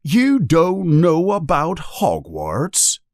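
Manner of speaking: high, slow incredulous question; shocked. A narrator's reading. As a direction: draw it out in disbelief. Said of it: "looking shocked".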